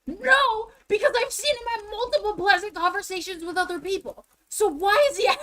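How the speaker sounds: loud, high-pitched indignant outburst; heated objection. Retold or imitated. Arguing with mom about the clerk, loud and indignant. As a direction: shout it in exasperated disbelief. Heated rebuttal to mom's excuse.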